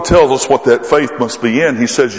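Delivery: ordinary delivery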